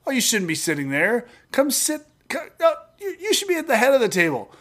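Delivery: high-pitched, fussing mock host; gracious host. Host moving a humble guest to the head table, mock gracious. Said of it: "better if somebody goes".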